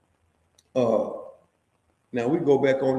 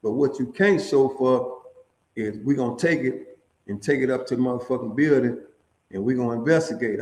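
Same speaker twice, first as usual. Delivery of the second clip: ordinary talk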